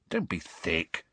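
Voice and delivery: low, soft but firm. A narrator's reading. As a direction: quietly put a friend straight. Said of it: "said Harry firmly".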